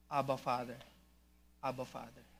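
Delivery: low, soft address to God; softly voiced, reverent cry; hushed prayerful call. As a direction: call on the Father quietly, as in prayer. Voicing the Spirit's cry to God.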